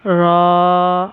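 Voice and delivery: रॉ said thick and full in the mouth; heavy ra. Shown as a demonstration. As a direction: give रॉ a heavy, rounded sound. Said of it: "इसे मोटा इस तरह पढ़ेंगे".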